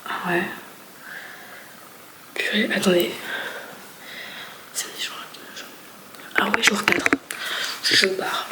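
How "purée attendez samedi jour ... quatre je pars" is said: hushed, breathy vlog narration; whispered vlog. Real, not acted. Whispering to viewers about the day's plans. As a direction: whisper it so nobody wakes up.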